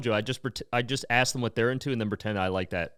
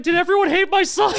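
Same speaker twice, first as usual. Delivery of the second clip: much higher, louder mom voice with laughter; mock grieving mom; 6 dB louder than usual. Mother at her son's empty funeral, mock wailing. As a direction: wail as the abandoned mother. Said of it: "she's like".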